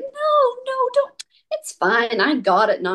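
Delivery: high, quick brush-off; over-eager no thanks; mock hasty refusal. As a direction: wave off the help, pretending. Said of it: "I'd be like".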